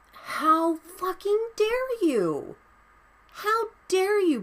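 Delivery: high, slow, disbelieving outrage; real fury, every word stretched; furious rant. Real, not acted. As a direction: drag out how fucking dare you. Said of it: "Sorry, rant over".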